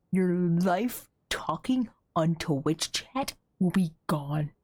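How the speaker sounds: ordinary talk, nothing marked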